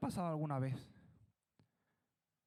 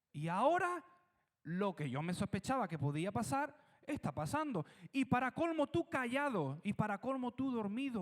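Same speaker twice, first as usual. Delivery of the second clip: scolding Jesus; 3 semitones higher than usual. Acted. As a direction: tell him off for landing you here. Disciple scolding Jesus in storm.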